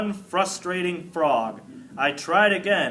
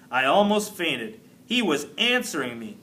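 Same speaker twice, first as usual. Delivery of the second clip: ordinary delivery, nothing marked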